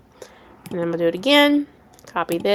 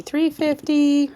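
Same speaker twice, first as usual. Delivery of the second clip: sing-song; 7 semitones higher, 3 dB louder than usual. Pretend.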